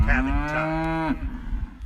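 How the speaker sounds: slow, drawn-out poem title; cowboy poetry. Shown as a demonstration. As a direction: announce the poem title slowly and proudly. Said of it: "with this cowboy poem".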